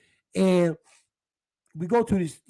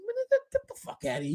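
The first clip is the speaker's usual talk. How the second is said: scoffing disbelief; 7 dB quieter than usual. Retold or imitated. Scoffing at cousin's bragging.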